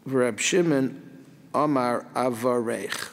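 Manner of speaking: normal talking voice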